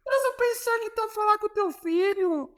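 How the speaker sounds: very high mock voice of an affronted coach; Jorge Jesus shouting. Coach scolds player for talking back, affronted high mock voice. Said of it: "ele grita assim".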